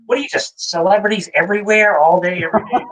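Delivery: high, incredulous mom voice; lightly voiced amazed mother; mom's amazement. Mom marvelling at celebrities everywhere. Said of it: "she's like".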